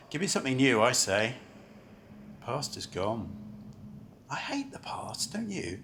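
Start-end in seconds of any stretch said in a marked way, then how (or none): 2.4-5.8 whispered aside. Acted. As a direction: whisper it like a confidence. Confiding aside at the station.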